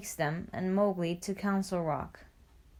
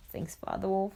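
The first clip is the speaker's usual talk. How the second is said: ordinary delivery, nothing marked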